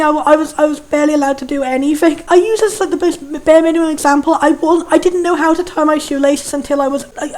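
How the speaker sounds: ordinary talk, nothing marked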